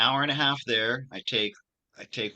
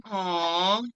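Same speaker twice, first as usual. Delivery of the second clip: high, louder mock "aww" of pity; imitated sympathetic aww; 10 semitones higher, 6 dB louder than usual. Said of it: "she wails and she cries".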